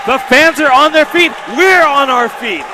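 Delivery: excited. Real, not acted. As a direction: share the crowd's thrill. Crowd standing after the finish.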